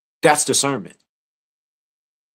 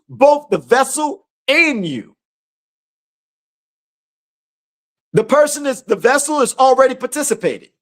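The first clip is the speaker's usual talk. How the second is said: full-force preaching; 13 semitones higher than usual. Acted. Preacher urging believers to hold still before spirits, full-force preaching. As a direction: preach it hard, driving every command home.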